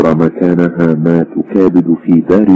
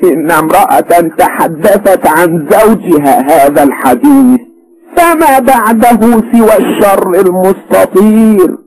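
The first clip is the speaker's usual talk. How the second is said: harsh; 3 dB louder than usual. A narrator's reading. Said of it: "فقالت في شدة".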